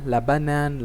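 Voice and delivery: ordinary delivery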